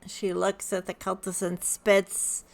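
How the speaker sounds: ordinary talk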